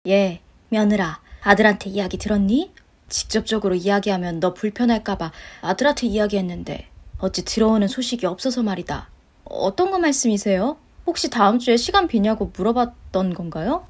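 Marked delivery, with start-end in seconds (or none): 0.1-9.1 tentative mother-in-law. Acted. Mother-in-law broaching a favour with her daughter-in-law, tentative.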